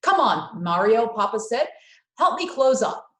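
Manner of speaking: loud call to Mario. Acted. Papa calling Mario to help close the stand, loud. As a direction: call him over to close up.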